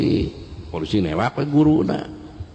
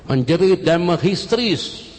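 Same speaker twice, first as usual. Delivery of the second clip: emphatic cry; 10 semitones higher, 5 dB louder than usual. Shown as a demonstration. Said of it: "langsung menjerit".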